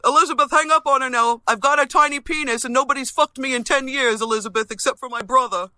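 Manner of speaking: loud, high mocking whine of her rival; mocking imitation. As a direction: shout her demand back in a taunting voice. Mimicking rival's plea to host.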